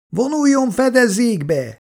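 very high, drawn-out cry; shouted warning. A narrator's reading. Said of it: "kiabálja Jay". (magas hangú, elnyújtott figyelmeztető kiáltás)

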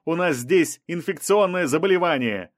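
mild narrated shout; shouted warning. A narrator's reading. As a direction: call out an urgent warning. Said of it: "крикнул Рубен".

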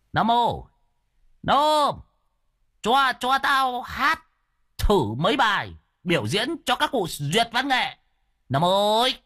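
high-pitched holler to a helper; bossy old man's high call. Acted. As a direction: hail your man across the yard. Đồng hailing Nam across the yard.

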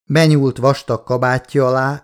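plain reading voice

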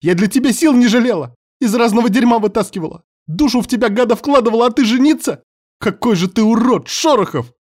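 high furious scream. Acted. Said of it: "Вавилия внезапно сорвалась на крик".

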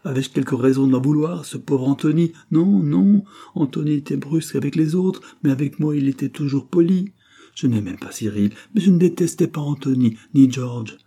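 loud, high, agitated denial; vehement protest. Acted. Confessing woman denying any grudge against Anthony, vehement. Agitated self-justification in anger.

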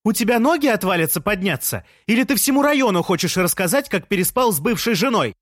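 high, scolding yell; outraged, high-pitched haranguing; indignant shout. A narrator's reading. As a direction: shout accusing questions down to the street. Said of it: "закричала Лея".

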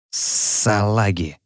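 slow menacing hiss; sinister hiss. Acted. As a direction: draw it out slowly and menacingly. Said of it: "зловеще прошипел Энис".

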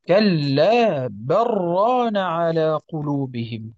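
"بل ران" run together as "بران"; slow, the lam of "بل" dropped into the ra; slow assimilated recitation. Shown as a demonstration. Teacher reading it as those without the pause do: "بل ران" merged. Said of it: "يقول".

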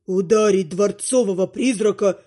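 a deep ghost, hurt and huffy; offended ghost. Acted. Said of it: "Обиженно взвыли". (обиженный голос призрака)

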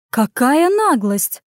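slow, indignant gasp of outrage; overseer's slow scandalised exclamation; outraged. Acted. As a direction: spit out the outrage, drawn out. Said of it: "возмутилась надзирательница".

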